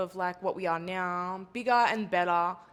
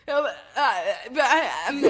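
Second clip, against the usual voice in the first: louder, laughing re-enactment of fumbling; flustered stammer; 3 semitones higher, 6 dB louder than usual. Retold or imitated. Recreating her botched question at checkout, flustered stammer. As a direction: stumble over fillers as if tongue-tied. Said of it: "recreate what literally came out of my mouth".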